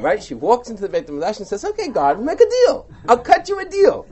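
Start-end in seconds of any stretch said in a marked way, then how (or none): 1.6-4.1 higher and louder, brash as if striking a bargain with God; cheeky bargaining tone. Retold or imitated. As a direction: pitch the deal to God with chutzpah. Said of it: "we hear your intonation".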